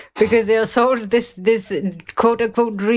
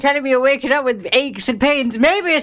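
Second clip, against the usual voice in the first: higher-pitched, exasperated rant; worked-up rant; 5 semitones higher than usual. Real, not acted. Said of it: "definitely feels some kind of way about this particular".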